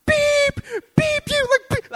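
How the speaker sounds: a shrieked beep standing in for swearing; bleeped cussing shout. Retold or imitated. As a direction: scream censored curses into a pillow. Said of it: "every cuss word that I know is coming out".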